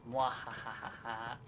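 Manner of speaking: evil laugh. Pretend. Spelled villain laugh.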